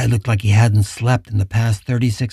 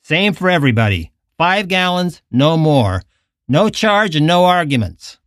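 firm and insistent, pitch pushed up; mildly harsh; 6 semitones higher than usual. A narrator's reading. Attendant refusing the girl driver more gas, mildly harsh. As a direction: spell out the rule, brooking no debate. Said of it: "the man said harshly".

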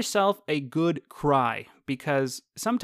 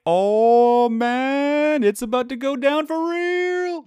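much higher, louder thrilled gasp; excited onlooker; 10 semitones higher, 7 dB louder, much slower than usual. Retold or imitated. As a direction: hype up the coming fight. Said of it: "and you're like".

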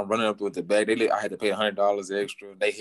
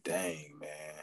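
much softer, fading sigh of defeat; 5 semitones lower, 13 dB quieter than usual. Retold or imitated. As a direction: let it die away. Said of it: "I was just like".

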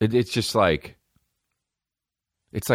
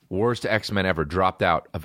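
ordinary talk

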